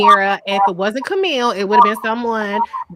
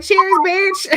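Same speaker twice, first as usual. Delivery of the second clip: gleeful toast; 9 semitones higher than usual. Retold or imitated. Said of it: "I'm like".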